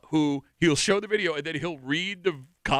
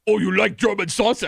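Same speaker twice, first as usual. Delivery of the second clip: louder mock German accent; 3 semitones higher, 5 dB louder than usual. Shown as a demonstration. Host correcting a co-host's impression, demonstrating a German accent. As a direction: put on a punchy German accent. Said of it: "That's not what it would sound like".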